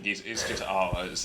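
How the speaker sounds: usual speaking voice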